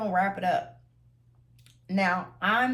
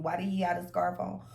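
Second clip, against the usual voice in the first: ordinary talk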